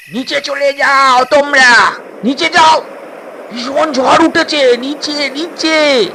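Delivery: high, loud warning over the storm; urgent shout. Acted. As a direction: yell them below deck. Sailor warns of storm.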